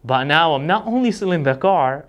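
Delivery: usual speaking voice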